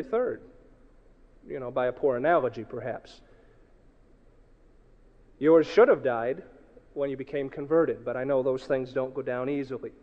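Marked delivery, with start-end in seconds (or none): none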